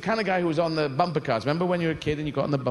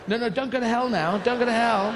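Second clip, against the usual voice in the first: urgent calling out; 8 semitones higher, 4 dB louder than usual. Acted. Urgently calling people back.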